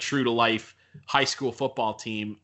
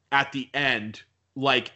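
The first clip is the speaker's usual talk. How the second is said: normal talking voice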